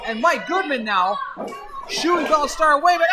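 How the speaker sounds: rising excited play-by-play; wrestling commentator. Acted. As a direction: call the big spot, building up. Live play-by-play climax.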